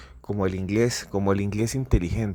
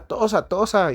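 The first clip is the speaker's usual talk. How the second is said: urgent urging; 11 semitones higher, slower than usual. Retold or imitated. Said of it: "le decía".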